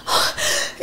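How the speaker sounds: soft unvoiced shocked gasp. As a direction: suck in breath in shock. Shocked at sister's pregnancy. (jadeo de sorpresa)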